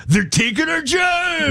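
high, drawn-out mock outrage; mock protest cry. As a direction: wail it like a hammed-up protester.

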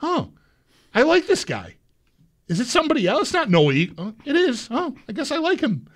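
high, airy mock surprise; a lightly voiced pleasantly surprised self; surprised self-quote. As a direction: play your own delighted discovery. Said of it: "I'm like".

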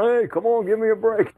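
high, punchy mock protest; sportscaster's outcry, pitched high; exasperated catchphrase. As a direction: throw it out as a signature call. Performs the catchphrase that caught on.

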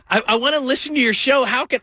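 a lifted, pleading parent voice; lightly mock the parent's plea; imitating parents. Host mocking parents asking about his show, lifted pitch. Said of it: "They're always asking, like".

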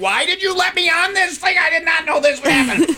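very high-pitched hammed-up shouting; acted high-pitched protest; panicked mock shout. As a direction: holler in mock outrage from a roller coaster. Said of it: "and I'd be like".

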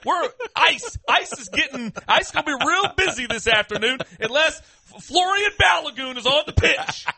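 gleeful taunt. Real, not acted. Gleeful mock threat.

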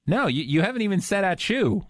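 ordinary talk

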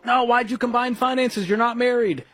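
high, mildly exasperated mock objection; mock scolding. Voicing usual scolding advice to couples.

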